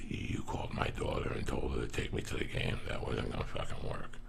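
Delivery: low, half-whispered gruff uncle; hushed uncle. Retold or imitated. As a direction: mutter it like a stern uncle.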